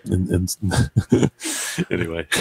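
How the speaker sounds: ordinary talk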